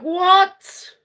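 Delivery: high, loud shocked "what?"; astonished. Retold or imitated. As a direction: blurt it out in high-pitched disbelief. Retold shock at correct guess.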